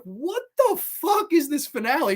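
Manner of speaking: high, incredulous outburst; exaggerated disbelief. Viewer reacting to the hammy finale, exaggerated disbelief. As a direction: act out being baffled.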